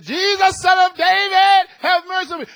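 high, loud pleading cry; yelling out. Retold or imitated. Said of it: "was yelling out to Jesus".

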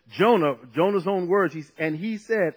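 ordinary talk, nothing marked

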